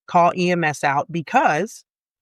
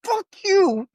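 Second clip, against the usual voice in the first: furious cry; 14 semitones higher than usual. Retold or imitated. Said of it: "I was like".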